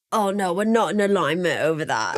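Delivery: low mock British put-on; lightly mocking British impression; imitating husband's British accent. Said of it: "You're like".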